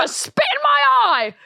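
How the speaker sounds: put-on very high Southern teen voice. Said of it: "Hannah Montana".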